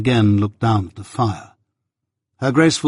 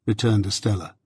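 usual speaking voice

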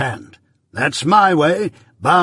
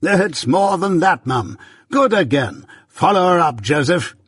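high, patronizing Pumblechook; grandly egging him on from above; 6 semitones higher, 4 dB louder than usual. A narrator's reading. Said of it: "said with a patronizing laugh".

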